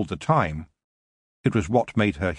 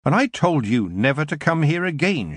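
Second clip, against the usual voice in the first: young witch confronting an old enemy, voiced higher; a firm warning, lightly raised voice; the young witch Tiffany recognizes the elf; Tiffany, stern; 6 semitones higher than usual. A narrator's reading. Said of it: "she said".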